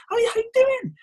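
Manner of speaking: very high, loud chirpy greeting; engaged and smiling. Shown as a demonstration. Coach greeting a caller with a smile, very perky. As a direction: squeal a delighted hello. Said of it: "I'm smiling".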